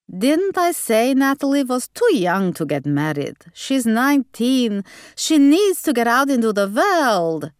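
high, emphatic Hungarian grandmother; Hungarian accent. A narrator's reading. Gran insisting Natalie is too young, Hungarian accent. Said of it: "stubbornly held on to her accent".

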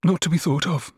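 high, choked resignation; suffocated. A narrator's reading. Eldest sister gives up her suitor, suffocated. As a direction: repeat it as if stifled, high. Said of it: "in a suffocated voice".

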